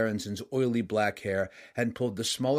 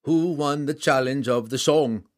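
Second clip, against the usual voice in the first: proud warrior king; 4 semitones higher, 6 dB louder than usual. A narrator's reading. Rival king answers.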